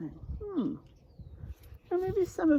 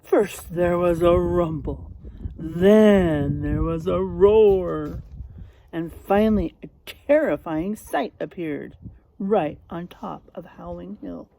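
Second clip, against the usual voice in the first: louder, dramatic storybook build-up; building story narration; 4 semitones lower, 7 dB louder than usual. Acted. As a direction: let the rumble and roar grow. Reads picture book to class.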